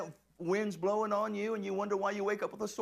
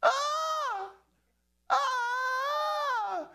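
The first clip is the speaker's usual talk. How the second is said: high hammed-up scream of fright; mock terrified scream; 3 dB louder than usual. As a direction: shriek up high like panicked disciples. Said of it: "they cried out for fear".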